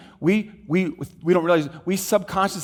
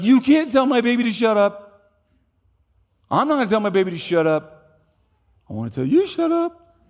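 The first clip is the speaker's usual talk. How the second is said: raised, firm voice, ending in a high jab; mock defiant mom; 7 semitones higher, 3 dB louder than usual. Mom tells the rude man off, in a raised mock-defiant voice. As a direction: stand up for the baby, half in jest. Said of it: "she's like".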